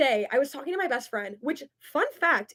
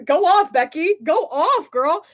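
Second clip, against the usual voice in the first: sassy; 4 semitones higher, 6 dB louder, slower than usual. Acted. Sassy reply to a comment.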